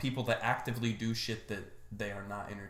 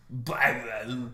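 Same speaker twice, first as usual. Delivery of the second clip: "Baguette." said louder with a French lilt; mock French "Baguette."; 6 dB louder than usual. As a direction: order "Baguette." like a fake Frenchman. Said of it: "walk up into a baker's shop and go".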